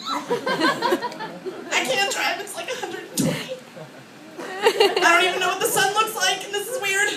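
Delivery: high, helpless wail; mock distressed whining. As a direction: whine in exaggerated distress while driving. Acting driving in scorching car.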